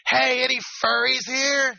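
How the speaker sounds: loud slow call across a crowd. Pretend. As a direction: shout the question to the room. Calling out to a crowd.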